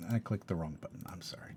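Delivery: breathy whisper to self; whispered aside. Real, not acted. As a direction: drop to a whisper, embarrassed. Muttered aside after a misclick.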